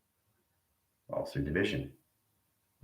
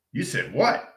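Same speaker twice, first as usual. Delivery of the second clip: normal talking voice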